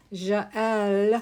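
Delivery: loud, playful French "Jael"; French accent. Shown as a demonstration. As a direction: draw out "Jael" in a hammed-up French accent, zhah-EL. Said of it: "have to say it with a French accent".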